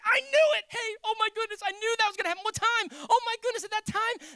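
high, loud burst of joy; can't contain the good news; excited shouts of disbelief and delight; overjoyed father. Retold or imitated. Father hears his son recovered.

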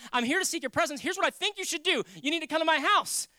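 loud, high urgent appeal; insistent and forceful, acting out a plea; bold plea. Acts out bold plea of faith.

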